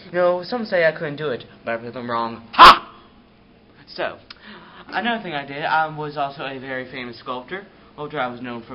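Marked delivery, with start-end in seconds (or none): 2.5-2.8 a loud, high barked "Ha!"; triumphant laugh. Acted. Michelangelo gloating to the interviewer with a loud, high "Ha!".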